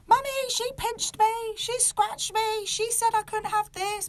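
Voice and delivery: put-on high, whining little kid; whiny child voice. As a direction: whine it like a tattling child. Kids' complaint voiced by mother.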